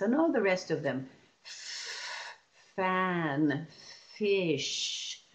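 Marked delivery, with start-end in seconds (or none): 1.4-5.2 "F" as a long hiss, "fan" and "fish" drawn out; slow sounding-out from a sustained f; stretched sounds. Shown as a demonstration. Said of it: "Those are stretchable sounds".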